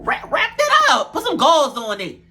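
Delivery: shrill pleading jump up, then down; exasperated high plea. Acted. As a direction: beg her to cover it, pitch flying up. Pleading with Jayla to bandage.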